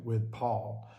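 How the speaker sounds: ordinary delivery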